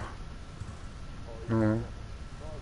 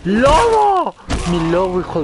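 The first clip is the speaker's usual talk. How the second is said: high, loud panicked shout; grito alarmado; 9 semitones higher, 6 dB louder than usual. Real, not acted. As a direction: scream the name in alarm. Su lobo en peligro. (grito de pánico)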